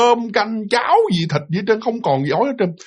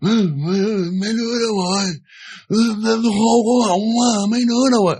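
put-on groaning old woman; 4 semitones higher, faster than usual. Great-grandmother calling for the family after waking, in a lightly moaning voice. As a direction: moan it out like a frail grandmother waking up. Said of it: "mà kiểu như bà cố rên".

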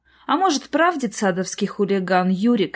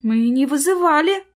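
higher-pitched, flustered protest; confused objection; bewildered mother; 7 semitones higher, 4 dB louder than usual. A narrator's reading. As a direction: say it at a loss, pitch climbing. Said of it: "растерянный голос мамы".

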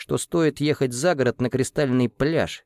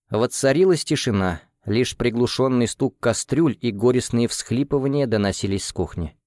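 plain reading voice

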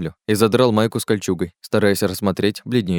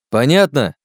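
louder, sharply higher repeat; insistent demand; 6 semitones higher, 6 dB louder, slower than usual. Acted. Oleg presses the silent trio for agreement, insistent and loud. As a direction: hit "понятно" high and loud.

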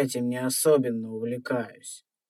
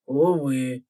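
mocking copy; 6 dB louder than usual. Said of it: "Скопировал его интонацию ящер".

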